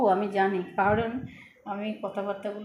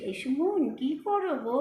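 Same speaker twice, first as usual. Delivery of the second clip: slow, higher lisping baby talk, imitating herself; mock babyish lisping; 4 semitones higher, slower than usual. Daughter mimics her mother's lisp to tease her, slow and higher. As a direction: play the daughter mocking mum's childish way of talking. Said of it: "ভেঙে ভেঙে নকল করে করে দেখায়".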